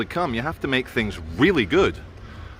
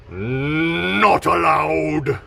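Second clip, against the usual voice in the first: very slow, higher, stretched-out words; drawn out; 4 semitones higher, much slower than usual. Acted. Vlogger announcing what is not allowed, drawn out. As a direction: stretch it out long and theatrically. Mock ban, drawn out in jest.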